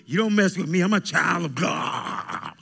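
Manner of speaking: high, loud mock swagger, voicing a boastful saint; mock cocky believer. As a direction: puff up and shout it like a know-it-all believer. Mocking a cocky churchgoer.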